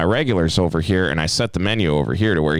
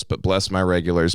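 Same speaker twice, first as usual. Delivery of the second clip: ordinary talk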